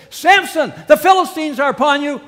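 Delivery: loud, high alarmed cry of warning; calling out alarm. Acted. Delilah shouting to sleeping Samson that enemies have come, alarmed cry. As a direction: shout the warning to wake him.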